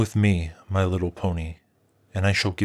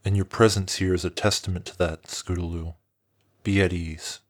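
soft friendly dragon; 6 semitones lower, 4 dB quieter than usual. A narrator's reading. Dragon reassuring the frightened pony, low and gentle. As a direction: reassure her like an old friend. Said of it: "nudged her gently".

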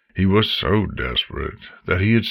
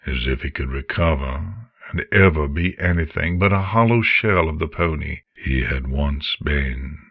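ordinary delivery

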